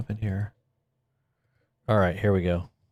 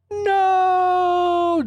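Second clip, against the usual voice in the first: high, loud wailing no; a mock howl of despair; anguished scream; 3 dB louder than usual. The son hears the truth and cries out a high, loud wailing no. Luke's anguished reply to Vader.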